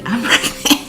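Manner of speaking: high-pitched, laughing confirmation; excited announcement. Retold or imitated. Wife telling her husband she is pregnant, excited and laughing. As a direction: burst out with the news, half laughing.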